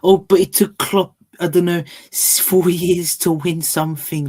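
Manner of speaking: mocked Top Gooners. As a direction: stretch out a mock excuse with a laugh. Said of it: "I heard Top Gooners were comparing".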